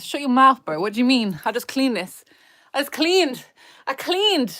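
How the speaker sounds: loud, punchy protest rising in pitch; indignant retort. Real, not acted. Streamer answering a jibe about the messy bedroom, indignant. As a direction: fire back, insisting harder each time. Snapping back at comment on messy room.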